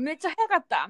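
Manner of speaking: playful imitated uncle; excited praise. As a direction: gush about her speed. Uncles recognizing her, retold.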